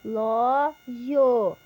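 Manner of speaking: "Relógio" and "mamadeira" slowly, syllable by syllable; broken into pieces. Shown as a demonstration. Teacher showing words cut into pieces for an activity, syllable by syllable. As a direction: pause between the chunks of "Relógio, mamadeira". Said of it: "em pedaços".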